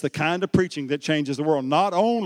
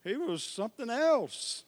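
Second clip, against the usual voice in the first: ordinary talk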